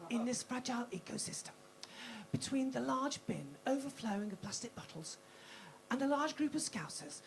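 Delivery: hushed wildlife documentary whisper; breathy Attenborough-style hush; nature documentary narrator. Acted. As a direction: narrate her like a rare animal, hushed. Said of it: "Got Lynn Brooke for this".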